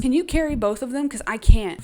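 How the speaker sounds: Katniss, desperate, urgently asks Finnick to carry both; urgent plea. Retold or imitated. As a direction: beg for help, out of options. Katniss begging Finnick for help.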